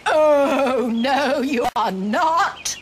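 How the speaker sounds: slow, defiant goat bellow; bellowing billy goat. A narrator's reading. Eldest goat defies the troll, slow bellow. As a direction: stretch it out in gruff defiance. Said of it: "bellowed the eldest billy goat Gruff".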